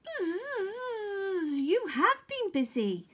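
bee friends. A narrator's reading. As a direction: buzz a friendly welcome as fellow bees. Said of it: "said Honeybee's friends".

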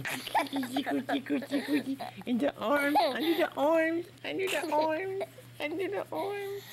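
high, loud sing-song tickling; playful tickling. Acted. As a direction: squeal the tickle chant at a pet. I'm going to tickle you.

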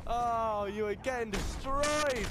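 loud, high whoops of triumph; excited cheering. Real, not acted. Player watching an enemy get destroyed, excited cheering. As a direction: crow over the knockout at full volume.

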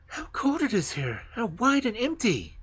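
put-on high, astonished boy. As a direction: look round in wonder. Kay voiced after waking.

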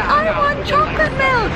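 put-on high whiny kid voice; a hint of a whiny little boy; child voice. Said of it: "Was like".

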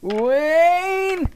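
high, drawn-out mock call; Garth Algar. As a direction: stretch the name out high. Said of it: "sound like Garth Algar".

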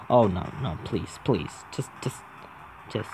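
soft low begging; pleading mutter. Real, not acted. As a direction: beg under your breath. Pleading with character.

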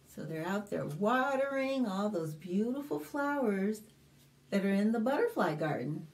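usual speaking voice

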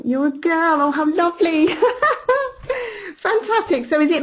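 high, loud delight breaking into laughter; delighted realisation. Real, not acted. Host learns the attendee is a woman, loudly delighted. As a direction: exclaim it with a big, high laugh.

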